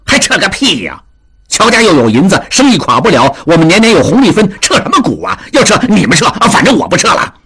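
啐道. A narrator's reading. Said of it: "达庆啐道".

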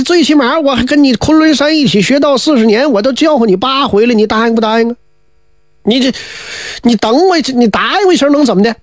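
a wheedling schemer feigning hurt; aggrieved whining fellow. Acted.

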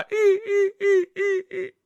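high, thin mock whimpering sobs; theatrical crocodile tears; fake sobbing. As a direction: whimper in little high sobs like a put-upon maiden. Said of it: "这位一掉眼泪".

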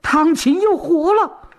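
high, urgent cry of alarm; high-pitched false alarm; sudden shout. A narrator's reading. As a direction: call out suddenly to turn every head. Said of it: "忽然又喊了一声".

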